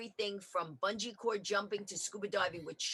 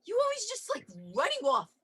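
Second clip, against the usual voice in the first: husband's complaint; 7 semitones higher than usual. Retold or imitated. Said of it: "He goes".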